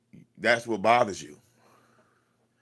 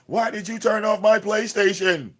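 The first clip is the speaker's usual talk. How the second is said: much higher, louder mock kid whine; mock a spoiled child losing his game; high-pitched bratty child imitation; whiny little kid; 11 semitones higher, 5 dB louder than usual. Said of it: "they lash out like little kids".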